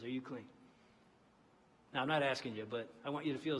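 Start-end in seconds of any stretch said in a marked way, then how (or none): 0.0-0.5 quiet stern question. Retold or imitated. Quoting the president's blunt question.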